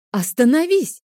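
loud, high cry to stop; urgent motherly outburst; alarmed command. A narrator's reading. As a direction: shout to halt a child at once. Mother halts overeating child.

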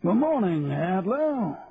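high, drawn-out officer's greeting; plummy colonel sailing in; hearty colonel. A narrator's reading. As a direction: hail the bank manager in a high hoot. Colonel greets manager on marching in.

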